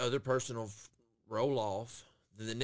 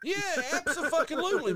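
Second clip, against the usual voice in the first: emphatic agreement; 4 dB louder than usual. Real, not acted. Host agreeing about lazy fast-food workers, emphatic. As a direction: burst out in full agreement.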